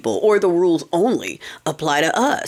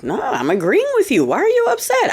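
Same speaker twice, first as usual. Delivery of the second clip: playfully innocent protest jumping much higher; mock innocence; 11 semitones higher than usual. Telling angry friends she's only agreeing, in mock-innocent high tones. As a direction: play dumb, wide-eyed and far too high.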